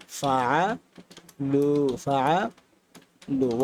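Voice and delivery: ফাআলু with the ending উ drawn out very slowly; slow teaching ফাআলু; long u drill. Shown as a demonstration. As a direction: stretch the final উ of ফাআলু. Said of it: "ওয়া সাকিন যোগ হবে".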